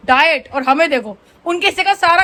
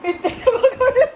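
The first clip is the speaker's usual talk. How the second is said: higher, giggling through the words; laughing; 9 semitones higher than usual. Real, not acted. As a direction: crack up at the tiny pakoras. Laughing at tiny pakoras.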